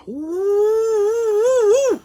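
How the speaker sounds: long high whoop after a strong swig; sustained falsetto "wooo"; high whooping "ooh". Real, not acted. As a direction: react to the burn of moonshine. Reacting to a moonshine swig.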